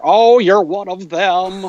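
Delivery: imitating a villain's forced American accent; Hans Gruber. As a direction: drawl it out like a smug movie villain. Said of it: "what he sounded like is, uh, Hans Gruber".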